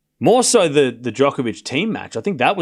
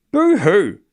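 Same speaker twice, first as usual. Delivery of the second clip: mock boo-hoo; 6 semitones higher than usual. Mocking chat for complaining.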